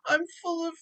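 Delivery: high, soft mock-tearful voice, broken by sniffles; about to cry. Shown as a demonstration. Teacher shows students the moment before crying, mock tearful. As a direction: play at holding back sobs. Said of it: "just before you have lots and lots of tears".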